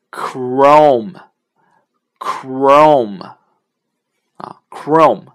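Chrome said slowly with a hard K at the start; Chrome with a K sound. Shown as a demonstration. Teacher showing Chrome starts with a K sound. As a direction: stretch Chrome out, K sound first. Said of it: "它发的是K的音".

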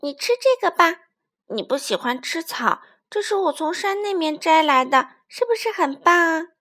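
put-on high, loud little-child voice; childlike pride, hoping for praise; proud baby dinosaur. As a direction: show off eagerly like a youngster.

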